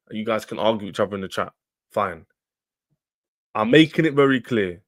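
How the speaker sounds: ordinary delivery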